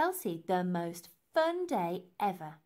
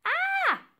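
very high, loud short scream; sudden high cry of pain; pained shriek; 8 dB louder than usual. Acted. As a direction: shriek as if poked in the eye. Elsie cries out, poked.

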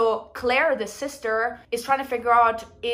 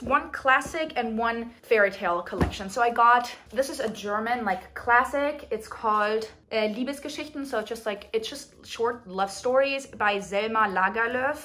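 ordinary talk, nothing marked

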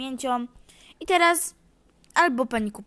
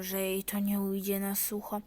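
hushed scheming aside; whispered aside; 4 semitones lower, 7 dB quieter than usual. Acted. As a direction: whisper it to yourself. Thought spoken aside.

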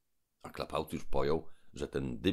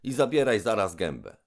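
loud, high defiance; Trull shouting; 7 semitones higher, 9 dB louder than usual. Acted. Trull shouts refusal at the robber. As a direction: refuse him at full voice.

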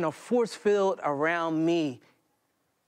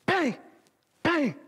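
mock gunshots; 9 semitones higher than usual. Gunshots voiced.

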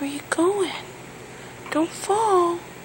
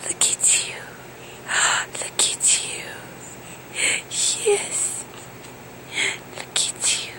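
whisper; 3 semitones higher, 7 dB quieter than usual. Real, not acted. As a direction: breathe the words softly to her. Whispering to baby.

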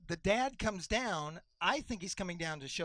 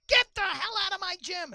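ref throwing him out; 12 semitones higher, 8 dB louder than usual. Retold or imitated. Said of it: "and he goes".

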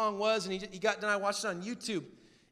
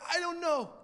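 imitated tearful struggle to speak; high, halting mock-crying voice; Simon Cowell; 7 semitones higher, 4 dB louder than usual. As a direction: choke out broken phrases, as if crying. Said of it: "And he's crying. He's like".

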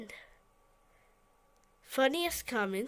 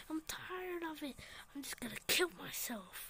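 despairing whisper; 10 dB quieter than usual. Acted. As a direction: breathe it out, done with life. Despairing chef mutters alone.

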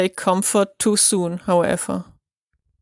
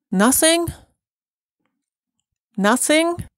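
high rising disbelief, higher; incredulous echo; 8 semitones higher than usual. Acted. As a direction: repeat it as if you can't believe it. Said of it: "Nothing? echoed in disbelief".

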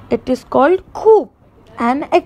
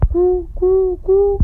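high, even, repeated coos; high pigeon imitation for children; 6 semitones higher than usual. Shown as a demonstration. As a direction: coo like a pigeon, pitched high. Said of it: "Pigeon coos".

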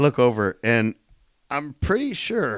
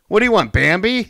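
higher and louder, hammed-up indignation; mock rant; 8 semitones higher, 9 dB louder than usual. Co-host ranting against Disney films, loud mock rant. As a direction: throw it out like a fake tantrum.

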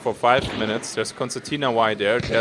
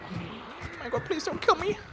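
very high, soft begging; frightened high whimper; high pleading; 9 dB quieter than usual. Real, not acted. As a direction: plead for your life, squeaky. Begging enemies not to kill.

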